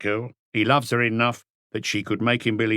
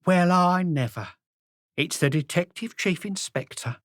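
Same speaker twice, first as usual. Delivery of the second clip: plump innkeeper woman; 9 semitones higher than usual. A narrator's reading. Plump landlady greets the inspector, high and surprised.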